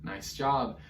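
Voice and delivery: ordinary talk, nothing marked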